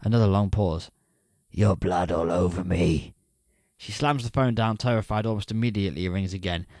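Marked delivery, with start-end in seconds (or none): 1.5-3.1 low, slow, heavy menacing growl; menacing stalker. Acted. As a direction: savour each word like a killer on the phone. Stalker caller reveals his intent.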